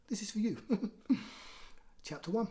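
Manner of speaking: ordinary delivery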